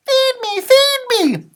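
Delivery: put-on very high peeping baby penguin; high, tiny chick peep. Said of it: "he peeped".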